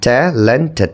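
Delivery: Talented in three distinct beats, ট্যা, লেন, টেড; segmented talented, each syllable on its own. Shown as a demonstration. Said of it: "three syllables".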